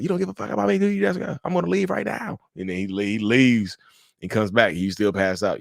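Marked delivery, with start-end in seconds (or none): 0.0-2.4 much higher, strained indignant yell; hurt rage, pitch shooting up; mock furious boyfriend. Boyfriend shouts at her while she sleeps, high angry yell. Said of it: "And he's like".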